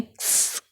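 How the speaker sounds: क्स as a soft breathed k-s with no vowel; ending sound demo. Shown as a demonstration. Teacher isolates the ending sound for pupils, soft k-s. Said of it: "इस तरह से लिख सकते हैं".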